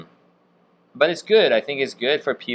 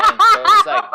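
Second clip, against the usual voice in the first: laughing; 7 dB louder, slower than usual. Real, not acted. Laughing while retelling teasing.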